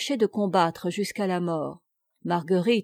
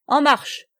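loud, high, commanding order; imperious command; 6 semitones higher, 6 dB louder than usual. A narrator's reading. Plélan sends his soldiers off, imperious and loud. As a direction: snap the men into motion. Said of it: "commanda impérieusement Guy de Plélan".

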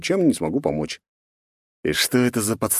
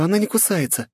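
put-on higher, teasing young woman; light girlish voice; 12 semitones higher than usual. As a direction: reassure him lightly, girlish. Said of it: "Милый".

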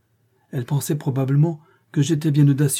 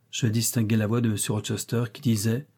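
plain reading voice

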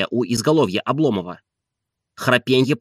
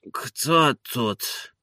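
softer, sleep-thick croak; just woken, hoarse and faint; 5 dB quieter than usual. A narrator's reading. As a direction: ask it softly through a sleepy rasp. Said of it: "спросил он хриплым голосом".

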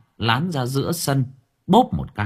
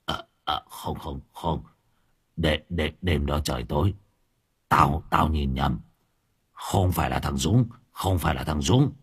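put-on frightened old man; 7 semitones lower than usual. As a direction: backpedal in fear. (ông già sợ hãi lắp bắp chối)

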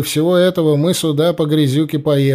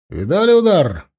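shouting drill sergeant; 4 semitones higher, 4 dB louder than usual. A narrator's reading. Sergeant boasting after strike.

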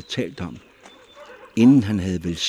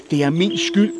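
high, loud anguished cry; louder, higher, blaming herself; shouting; 10 semitones higher, 7 dB louder than usual. A narrator's reading. Mother cries out her guilt to the crowd, loud. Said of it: "råbte hun".

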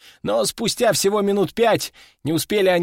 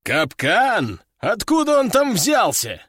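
puzzled question jumping higher; startled disbelief from the general; surprised; 5 semitones higher, slower than usual. A narrator's reading. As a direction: ask in sudden surprise. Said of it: "удивился мужчина с усами".

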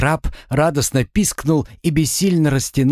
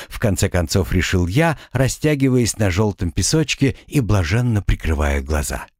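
ordinary delivery, nothing marked

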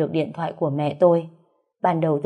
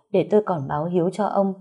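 ordinary delivery

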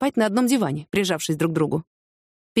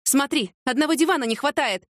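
excited cry; 7 semitones higher than usual. A narrator's reading. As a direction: cry out a discovery without raising volume. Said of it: "закричала Яна". (удивлённый вскрик, высоко, но негромко)

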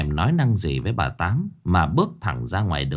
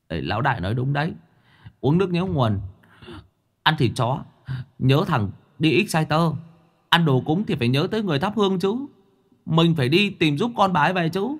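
high, fast jokey ghost voice; cheeky ghost; 7 semitones higher, faster than usual. A narrator's reading. A joking ghost eagerly backs his leader.

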